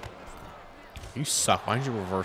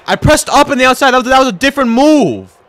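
much higher and louder frantic complaint; exasperated protest; 12 semitones higher, 13 dB louder than usual. Real, not acted. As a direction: protest the controls loudly. Protesting wrong move.

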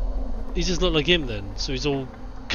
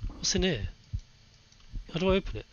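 usual speaking voice

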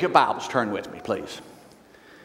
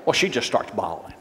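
ordinary talk

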